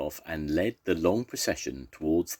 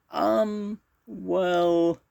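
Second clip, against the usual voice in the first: high, halting little voice; timid hesitation; 3 dB louder than usual. A narrator's reading. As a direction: stall nervously in a small high voice. Timid Basil hesitates before asking.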